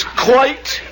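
one blasé word in a put-on English accent; unruffled British husband; nonchalant English hero. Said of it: "And he says".

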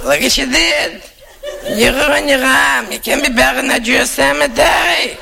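put-on very high, screeching old aunt scolding. Aunt scolds her nephew over the tattoo, shrill and high. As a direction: play the horrified aunt, screeching.

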